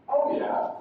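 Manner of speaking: "Oh yeah" high on OH, falling away; falling intonation. Shown as a demonstration. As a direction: start "Oh yeah" high, drop on YEAH. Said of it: "it's gonna be falling intonation".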